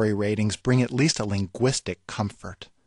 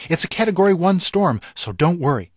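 mock-reassuring forecaster, pitched higher; calm TV weatherman; 6 semitones higher, 5 dB louder than usual. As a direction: play the weatherman telling everyone to relax. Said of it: "breathless updates from weather people".

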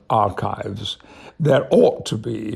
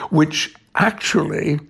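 normal talking voice

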